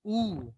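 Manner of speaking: उ drawn out past two beats; a three-beat pluta उ; prolonged u. Shown as a demonstration. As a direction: hold the उ as long as you can. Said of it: "ऐसे बोला जाएगा".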